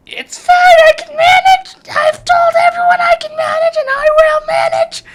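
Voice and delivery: very high, loud screech; frantic insistence. Real, not acted. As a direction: screech it out, insisting you can cope. Insists on coping after spill.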